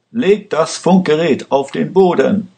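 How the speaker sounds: high, sharp shouted order at gunpoint; a fugitive shouts at the guard to drop the radio; shouting. A narrator's reading. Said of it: "schrie er".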